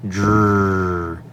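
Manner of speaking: dr said slow and drawn out as a bare sound; the dr blend voiced clearly on its own; phonics sound dr. Shown as a demonstration. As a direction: sound out dr for the student to repeat. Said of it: "I'll produce".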